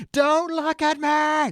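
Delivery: high, slow wailing plea; screaming steward quote. Retold or imitated. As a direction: wail it, drawn out and high. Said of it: "the whole screaming".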